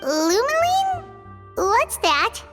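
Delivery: high, slow, drawn-out query; baffled, stretched-out repeat; puzzled question. Acted. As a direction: echo the strange word, pitch climbing high. Puzzled by unknown word.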